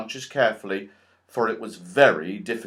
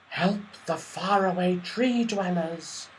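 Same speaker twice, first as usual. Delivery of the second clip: slow, higher, airy voice of the leaves; whispering leaves; 6 semitones higher than usual. A narrator's reading. Trees pleading with the children, slow and airy. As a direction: breathe it out slowly like rustling leaves. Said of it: "the leaves whispered".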